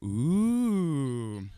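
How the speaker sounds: loud, swooping schoolkid "ooh"; a drawn-out ooh, rising high then falling; mock teasing ooh. Classmates tease the kissing pair, mock swooping ooh. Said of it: "They're like".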